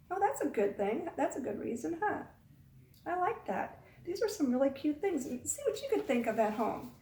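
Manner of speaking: ordinary delivery, nothing marked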